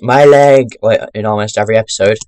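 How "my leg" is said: mock louder yell of my leg; a hint of the cartoon guy yelling; SpongeBob my leg guy. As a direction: shout it like the cartoon extra. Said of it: "the guy in SpongeBob who, like, shouts".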